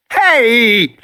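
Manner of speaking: a high, loud shout while falling; sharp shout. Acted. Knocked down by the dinosaur, a high loud shout. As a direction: cry out as you're knocked down. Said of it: "Regis shouted as he fell".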